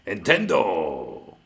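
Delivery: stretched slow Nintendo in a fake Spanish accent; a hammed-up Spanish drawl on one word; mock Spanish accent. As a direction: drag out Nintendo like a crooner. Mocking Spanish-speaking streamer.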